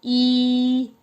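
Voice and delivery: ई held long and high, teeth pressed together; long ई sound. Shown as a demonstration. Teacher models ई for children to repeat. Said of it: "उच्चारण कीजिए मेरे साथ".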